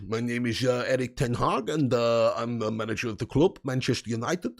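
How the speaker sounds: put-on lightly Dutch-accented Ten Hag, low and soft; a hint of the Dutch manager; Ten Hag impression. Reading a chat request for Ten Hag, low mild Dutch accent. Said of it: "Can you do Ten Hag?".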